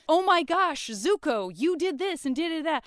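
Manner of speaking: high, loud, breathless gushing; gushing mock excitement. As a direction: gush over him like an overeager friend. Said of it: "There's a bunch of".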